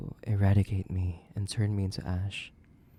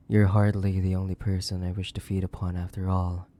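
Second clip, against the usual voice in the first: ordinary talk, nothing marked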